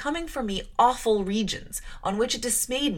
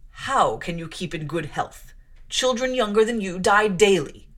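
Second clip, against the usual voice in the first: stern clergyman; 4 dB louder than usual. Acted. Clergyman warning the small girl of death, grave and loud. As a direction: lay down doom like a strict preacher.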